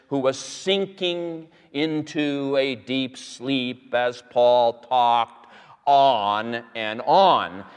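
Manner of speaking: slow, stretched words sinking into sleep; drawn-out drowsiness. Acted. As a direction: drag it out like an endless sermon. Dramatizes drowsy, endless sermon.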